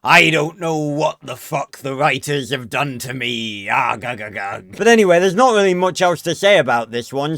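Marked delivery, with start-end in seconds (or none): 0.0-4.8 growly hammed-up pirate, opening with a shouted Arr. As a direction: play a cartoon pirate captain. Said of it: "He sounds like a fucking pirate".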